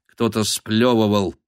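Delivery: ordinary delivery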